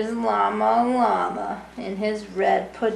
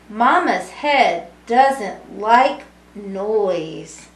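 lively sing-song storybook reading; reading to children; 6 dB louder, slower than usual. A narrator's reading. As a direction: read it warmly to a room of kids. Reading picture book to children.

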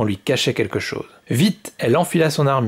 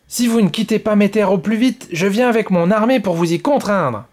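higher, louder curt warning; sharp cold threat, pitched up; 8 semitones higher, 3 dB louder than usual. Acted. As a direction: threaten him sharply like a proud child queen. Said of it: "répondit sèchement la petite reine Rouge".

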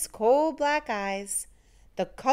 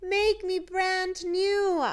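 slow little puddle voice; 9 semitones higher, slower than usual. A narrator's reading. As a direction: call up from the puddle, drawn out. Voice from the hot tub.

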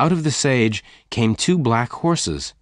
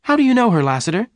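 very high, loud demand; quick, startled questioning; 13 semitones higher, 6 dB louder, faster than usual. A narrator's reading. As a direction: question him in a high, astonished voice. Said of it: "Jane asked quickly".

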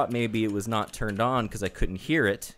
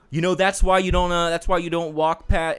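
driver scolding; 7 semitones higher, 6 dB louder than usual. Retold or imitated. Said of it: "he was like".

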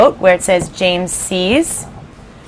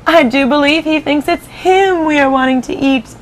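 higher amused ladybug voice; 7 semitones higher than usual. Acted. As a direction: let her find it a funny idea. Ladybug keeps speaking.

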